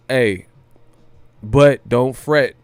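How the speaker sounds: usual speaking voice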